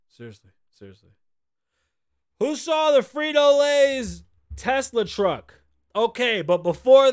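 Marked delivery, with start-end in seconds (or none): 0.1-1.1 muttered echo. Acted. 2.4-5.6 slow shouted question; loud slow call-out. Real, not acted. Calling out to audience.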